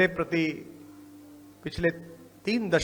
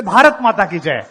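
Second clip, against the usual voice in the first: the slogan shouted out much louder; louder on request; 11 dB louder than usual. Retold or imitated. Seatmate told to speak up on the plane, shouting the slogan loud. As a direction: belt it out because he was told to speak up. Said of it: "थोड़ा जोर से बोलिए".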